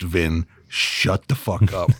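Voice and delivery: ordinary delivery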